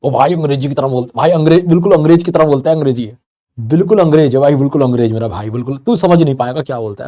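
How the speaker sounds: loud, mock-awed village bumpkin marveling at English; awed villagers. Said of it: "गाँव वाले होंगे, वो आपको देखेंगे". (गाँव वाले की नकल, ज़ोर से और हैरानी से)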